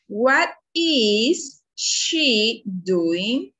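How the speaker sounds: very slow, drawn-out classroom question; word by word, drawn long with clear gaps; slow modelled question. Shown as a demonstration. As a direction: stretch out each word for learners.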